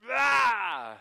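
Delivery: loud, high joyful shout of "ah"; spirit-filled exclamation; ecstatic cry. Real, not acted. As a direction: let out a sudden cry of joy.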